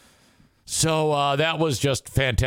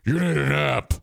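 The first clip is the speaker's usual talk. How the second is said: corrected friend voice; 2 semitones lower than usual. Retold or imitated. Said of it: "She doesn't sound like Maureen".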